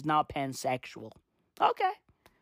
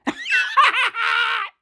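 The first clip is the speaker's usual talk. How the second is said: very high shrieking laugh. Real, not acted. Laughing at own joke.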